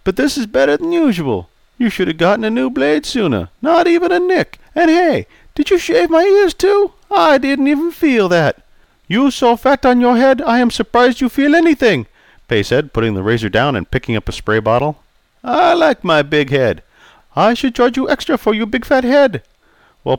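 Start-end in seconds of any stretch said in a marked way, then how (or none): 0.0-8.6 put-on raised, jovial old customer. Charlie delighted. 9.1-12.1 put-on raised, accented old barber voice; polite Chinese-accented barber greeting. 15.4-16.8 put-on raised, jovial old customer. 17.3-19.4 put-on raised, accented old barber voice; polite Chinese-accented barber greeting.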